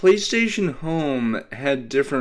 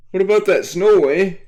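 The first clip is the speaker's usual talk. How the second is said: loud, high mock Canadian accent with a tagged-on eh; attempt at Canadian accent; 9 semitones higher, 9 dB louder than usual. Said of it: "it was an attempt at a Canadian accent".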